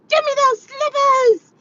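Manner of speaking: high shrieking witch demand; Wicked Witch voice. Retold or imitated. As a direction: screech it like the witch. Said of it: "she was like".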